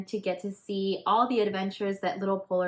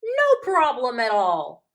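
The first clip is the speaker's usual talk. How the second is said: loud, bright-hearted hippo voice; cheerful hippo; 6 semitones higher, 11 dB louder, slower than usual. Acted. Hippo reassures the cub who can't swim, loud and cheerful. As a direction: brush off the worry with big cheer.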